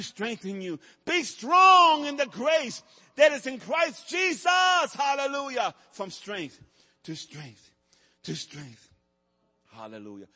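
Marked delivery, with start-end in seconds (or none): 1.0-5.7 high, loud proclamation; shouted preaching. Real, not acted. Preacher calling the church to be strong, shouting. 5.9-10.3 much softer and lower, fading; sudden hush. Real, not acted. Preacher softly repeating strength after the shout.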